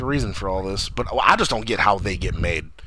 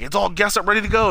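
eager announcement; 10 semitones higher, 4 dB louder than usual. Retold or imitated. Retold eager announcement to the brothers.

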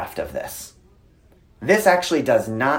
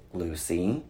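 low, soft warning of a name; retold stern warning; 5 semitones lower, 9 dB quieter than usual. Dad in standoff with toddler, quiet low warning. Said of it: "take my stance".

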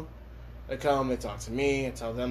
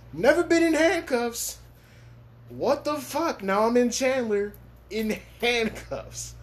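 incredulous; 10 semitones higher, 4 dB louder, slower than usual. Retold or imitated. Disbelief retold, pitch up.

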